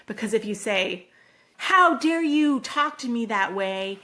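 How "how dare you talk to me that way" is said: loud, emphatic acted scolding; indignant parent. Parent snapping back at teen, indignant scolding. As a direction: come down hard on the child, playing offended. Voicing an escalating parent's retort.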